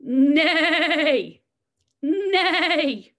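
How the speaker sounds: loud neighing horse call, held long; whinny like a horse. Shown as a demonstration. Teacher showing preschoolers the horse sound, loud neigh. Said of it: "Let's practice together".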